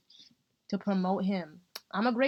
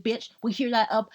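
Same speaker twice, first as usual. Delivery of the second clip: normal talking voice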